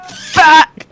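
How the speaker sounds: loud frustrated shout; sudden loud curse. Real, not acted. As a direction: blurt it out loud. Sudden outburst.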